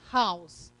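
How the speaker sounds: House with a sounded H; English H pronounced in House; English aspirated H in House. Shown as a demonstration. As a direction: breathe out the H of House. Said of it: "consigo colocar algum som aqui no H".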